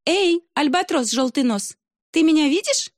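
put-on high girlish call to the albatross. Русалка зовёт альбатроса с камня, высоким звонким голосом. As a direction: sing it out high like a little mermaid. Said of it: "позвала".

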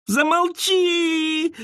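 high, drawn-out shriek. A narrator's reading. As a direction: scream it in terror. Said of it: "заверещала Мэри".

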